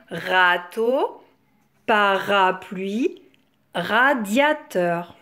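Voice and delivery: "râteau, parapluie, radiateur" slowly, in measured beats; syllable by syllable. Shown as a demonstration. Teacher having children place a syllable, "râteau, parapluie, radiateur" slowly segmented. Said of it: "où est placée la syllabe rat".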